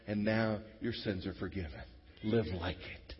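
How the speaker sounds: soft, quiet reassuring blessing; tender blessing. Retold or imitated. Believer softly blessing a guilty brother, tender and quiet. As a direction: speak softly like forgiving a brother.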